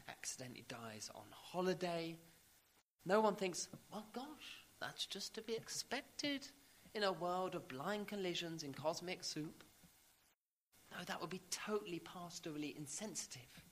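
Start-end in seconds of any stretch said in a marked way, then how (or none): 3.9-9.6 mock-casual musing. Preacher voices what nobody thinks in tragedy, mock-casual. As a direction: muse it quietly, as if tragedy were unremarkable.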